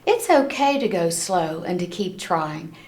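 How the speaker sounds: ordinary delivery, nothing marked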